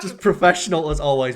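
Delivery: loud, laughing sarcasm; deadpan praise while cracking up; sarcastic. Real, not acted. Teasing guest's chip-mouth intro.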